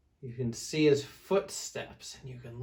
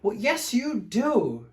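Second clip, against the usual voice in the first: slow, loud, much higher shout; mock shout; 9 semitones higher, 6 dB louder, slower than usual. Fluffy Bear shouts at Smelly Bear, mock shout. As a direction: shout back, fed up. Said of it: "shouted Big Fluffy Bear".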